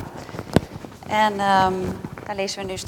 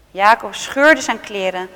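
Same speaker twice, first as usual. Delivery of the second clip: usual speaking voice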